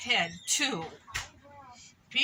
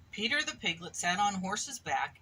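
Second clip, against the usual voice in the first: ordinary delivery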